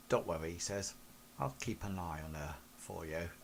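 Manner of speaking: low, hushed big-brother promise. Acted.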